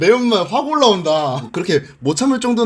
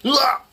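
pained yelp; 8 semitones higher, slower than usual. Real, not acted. Smelling the super spicy ramen.

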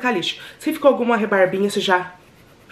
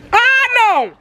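high, loud cry of dismay; shrieked protest at a stink; outraged cry; 13 semitones higher, 6 dB louder, much slower than usual. Real, not acted. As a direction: shout in disgusted protest. Outcry at a sudden bad smell.

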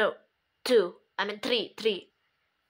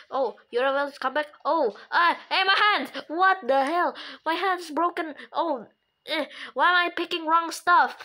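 ordinary talk, nothing marked

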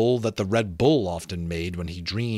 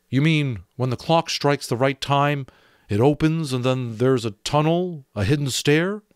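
doubtful; 5 semitones higher, 4 dB louder than usual. Acted. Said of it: "His voice was doubtful".

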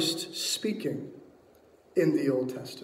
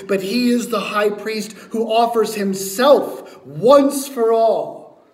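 loud, raised, driving sermon delivery; full-force preaching; 5 semitones higher, 7 dB louder than usual. Real, not acted. Preacher proclaims the high priest to the congregation, full-force preaching. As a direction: preach it hard, building to the last words.

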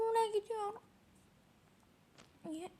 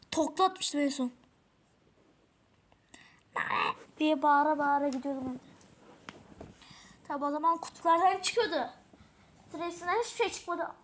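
usual speaking voice

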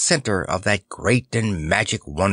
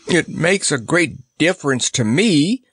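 higher-pitched, mildly plaintive lion; mumbling lion; 6 semitones higher than usual. A narrator's reading. Cowardly lion answers the soldier, mildly mumbling. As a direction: lightly voice a cowardly lion's complaint. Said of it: "mumbled the Cowardly Lion".

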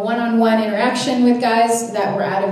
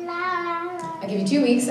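higher, slow, offering terms; higher, drawn-out offer; mock bargain; 7 semitones higher, 4 dB quieter, slower than usual. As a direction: bargain slowly with God. Negotiating with God.

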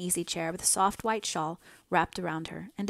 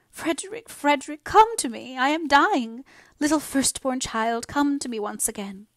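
high, loud hysterical wail; agonised cry; 7 semitones higher, 5 dB louder than usual. A narrator's reading. Dying mother crying out for her son, agonised. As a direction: call desperately for a lost child. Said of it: "suddenly cried out loud, as in some sharp agony".

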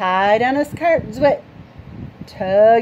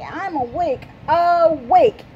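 insisting awake; 7 semitones higher, 3 dB louder than usual. Acted. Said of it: "cried Froggy".

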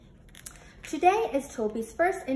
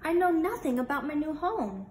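higher, louder eager yes; excited puppy; 5 semitones higher, 4 dB louder than usual. Acted. Puppy eagerly accepting the falcon's offer, excited and higher. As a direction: bounce with excitement. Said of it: "Look at how excited Toby is".

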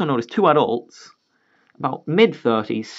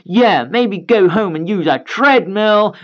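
put-on bratty kid taunt; 6 semitones higher, 4 dB louder, slower than usual. Kid keeps taunting daughter.

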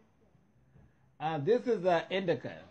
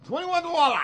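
much higher, louder squeal on dollars; excited squeal; 14 semitones higher, 8 dB louder than usual. Real, not acted. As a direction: squeal the price out delighted. Repeating the price.

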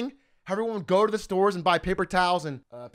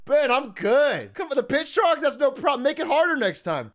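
higher-pitched, overcompensating mock confidence; false bravado; 5 semitones higher than usual. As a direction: pretend to be fine while wrecked. Acts false bravado to trainer.

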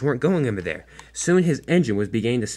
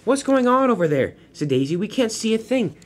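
high engine voice; 10 semitones higher than usual. Acted.

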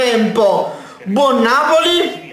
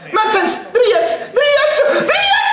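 high calls climbing to a scream; escalating excited shout; 11 semitones higher, much slower than usual. Real, not acted. Fan willing Mertens to score, escalating screams. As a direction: urge the striker on, pitch rising with each call.

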